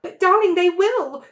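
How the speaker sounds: mother on the edge of tears; sobbing. A narrator's reading. Mrs Fox pleading with her husband, sobbing. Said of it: "sobbed Missus Fox".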